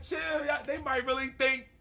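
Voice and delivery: high, urgent retold plea; half-laughing, high-pitched warning; amused alarm.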